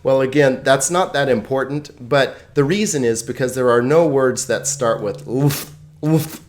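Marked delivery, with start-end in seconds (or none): 5.2-6.4 lf attempted as one sound without a vowel; unworkable consonant cluster. Shown as a demonstration. Teacher showing lf doesn't work together, the cluster forced out. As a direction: try to start a word with lf. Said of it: "It doesn't work together".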